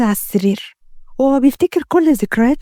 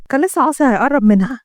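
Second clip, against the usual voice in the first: ordinary delivery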